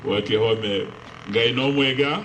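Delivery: normal talking voice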